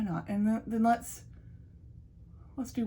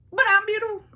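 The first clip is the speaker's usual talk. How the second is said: mock vain; 12 semitones higher, 6 dB louder, faster than usual. Joking vanity boast.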